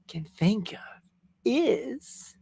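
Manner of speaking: dramatic whispered build-up; whispering. Real, not acted. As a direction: whisper it like a secret.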